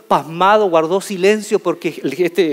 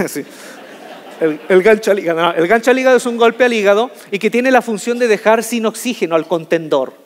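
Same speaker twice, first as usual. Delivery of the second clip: ordinary delivery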